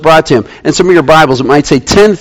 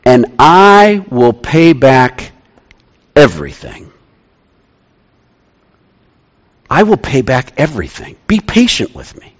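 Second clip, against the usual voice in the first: soft pleading; 4 semitones lower than usual. Retold or imitated. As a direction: beg quietly, winding down to near silence. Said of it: "he begged".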